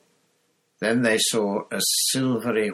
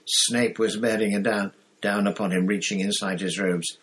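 ordinary delivery, nothing marked

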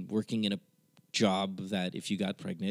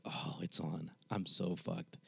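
retold dread; 5 semitones lower, 11 dB quieter than usual. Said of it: "And I was like".

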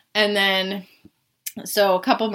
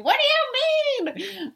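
put-on very high, slow, drawn-out drunk whine; much slower than usual. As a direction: stretch it out, tipsy and baffled. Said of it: "my drunk voice".

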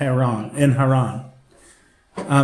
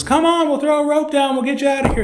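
very high-pitched calling-out; mock urgent call; 11 semitones higher than usual. Helicopter crew calling to man on the roof, mock urgent. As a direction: call down urgently from above, joke-telling style.

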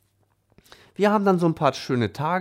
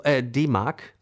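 ordinary talk

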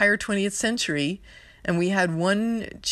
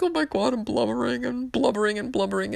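mock blubbering; 7 semitones higher than usual. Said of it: "I found myself really emotional".

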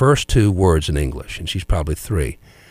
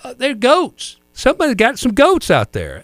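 grandmother's quip. Retold or imitated. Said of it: "They're not goats".

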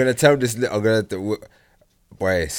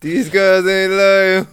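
higher, slow put-on complaint; mock lament; 8 semitones higher, 5 dB louder, much slower than usual. A friend echoing the disgust in a put-on voice. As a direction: drag it out like a sad anthem.

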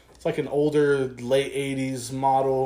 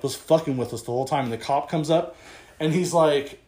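normal talking voice

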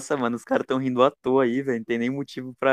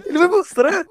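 laughing hard; 12 dB louder than usual. Real, not acted. Group giggling at Frank.

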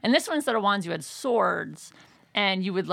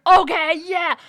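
loud, high eager okay; thrilled loud agreement; eager yes; 9 semitones higher, 10 dB louder, slower than usual. Retold or imitated. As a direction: jump at the idea.

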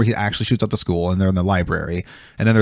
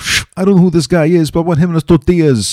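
much higher, louder hammed-up gangster; mob boss voice; 9 semitones higher, 5 dB louder than usual. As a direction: ham up a menacing mob boss, pitched way up. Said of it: "He's like".

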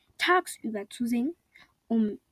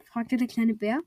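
ordinary delivery, nothing marked

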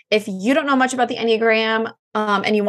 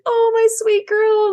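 much higher, slower, louder loving coo; tender mom; 14 semitones higher, 4 dB louder, slower than usual. Retold or imitated. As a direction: melt over your daughter. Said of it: "I'm like".

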